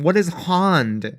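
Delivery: slow, with "hand" stretched to "hahnd"; mimicked broad A. Host challenging actor's pronunciation, "hand" as "hahnd". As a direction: draw out "hand" with a long "ah".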